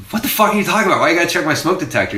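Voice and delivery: incredulous. Retold or imitated. Retorting to maintenance man at door.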